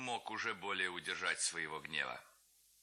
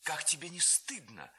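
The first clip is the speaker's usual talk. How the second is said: put-on reproachful girl; 6 semitones higher than usual. A narrator's reading. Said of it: "сказала Ганна".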